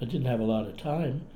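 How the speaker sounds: usual speaking voice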